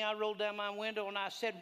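loud, high preaching at full force; fired-up preaching. Acted. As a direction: preach it like a revival.

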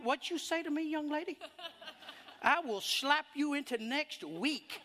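mock threat to a daughter, laughing at the end; sassy mother attitude. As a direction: play the fed-up mom daring her girl. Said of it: "I'm talking that attitude you have".

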